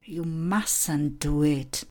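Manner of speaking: slow stern command. Shown as a demonstration. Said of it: "buďte přísní".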